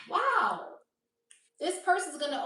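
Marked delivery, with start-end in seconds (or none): 0.1-0.7 surprised wow. Real, not acted. Reacting to tarot spread.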